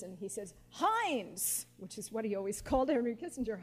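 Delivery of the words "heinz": a higher, louder call of the name Heinz, imitating Haldeman; booming voice. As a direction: call "Heinz" across the room the way Haldeman did. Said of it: "Haldeman's booming voice".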